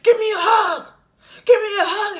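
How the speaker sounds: very loud, high holler; explosive, high-pitched yell, acted out; crazed demanding shout. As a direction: bellow it like a man gone wild. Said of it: "he looked like an action figure".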